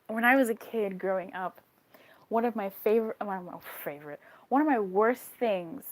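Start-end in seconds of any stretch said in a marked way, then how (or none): none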